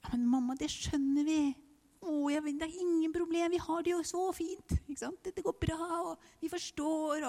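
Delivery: a hint of sweet kids reassuring mum; playing the kids saying all is fine; imagined children reassuring. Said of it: "at de kom til å bare si sånn".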